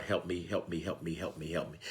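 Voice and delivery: very soft, low fading "help me"; fading plea. Real, not acted. Preacher pleading with God, fading soft and low. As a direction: let the plea die into a murmur.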